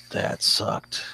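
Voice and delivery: a whispered, low last remark; dying whisper. Acted. Enemy with his face sliced off, whispering his last words. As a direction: breathe it out as the life leaves. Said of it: "he's like".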